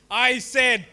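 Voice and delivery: normal talking voice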